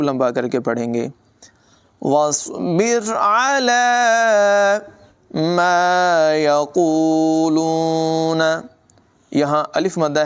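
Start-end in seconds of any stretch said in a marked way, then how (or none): 2.0-8.4 slow, higher melodic tajweed chant; Quran recitation. Shown as a demonstration. Teacher recites the verse for students, slow melodic chant. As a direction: chant each word long and flowing.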